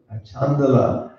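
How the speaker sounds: ordinary delivery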